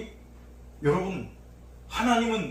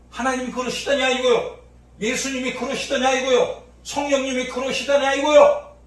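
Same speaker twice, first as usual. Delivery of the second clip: each 이거요 pressed harder, louder at the end; building challenge; 5 dB louder than usual. Acted. Preacher challenging the congregation, rising force on 이거요. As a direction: hammer the question home.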